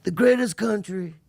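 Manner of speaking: throaty Trump line; lightly voiced Trump; Trump impression. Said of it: "sit like this".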